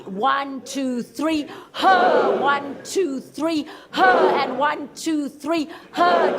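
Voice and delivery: loud huh breath release; counted release shout. Shown as a demonstration. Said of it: "do it on the count of three".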